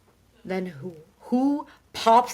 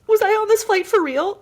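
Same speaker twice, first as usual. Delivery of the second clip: acted disbelief; 12 semitones higher, 6 dB louder than usual. Retold anxious question at the gate.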